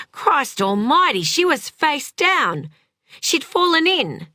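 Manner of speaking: alarmed. A narrator's reading. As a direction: voice leaps up in shocked dismay. Man sees girl drowning.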